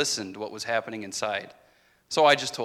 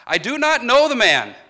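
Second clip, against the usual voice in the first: high, loud furious denial; angry outcry; 11 semitones higher, 10 dB louder than usual. Retold or imitated. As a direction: shout it to make them leave him alone. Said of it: "I cried out in anger".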